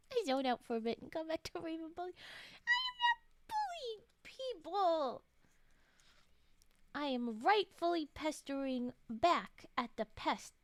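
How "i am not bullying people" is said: high whiny protest; mock whine.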